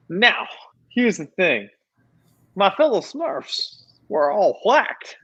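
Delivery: high, slow little Smurf voice. Acted. Speaks as a Smurf.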